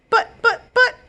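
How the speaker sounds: high, loud stammering protest; sputtering. Acted. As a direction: sputter helplessly. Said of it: "Mister F G sputtered".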